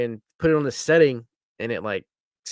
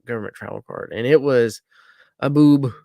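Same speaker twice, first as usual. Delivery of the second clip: ordinary talk, nothing marked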